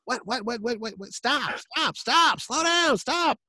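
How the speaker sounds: high, incredulous sputtering, slowing on the stops; exasperated Chaykin. Retold or imitated. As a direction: mimic a flustered older artist cutting off a fan. Chaykin berating a young fan.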